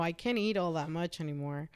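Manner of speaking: normal talking voice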